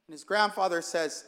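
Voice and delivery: usual speaking voice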